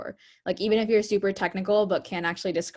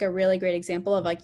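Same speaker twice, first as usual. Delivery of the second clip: normal talking voice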